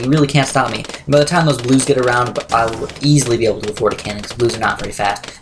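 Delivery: ordinary talk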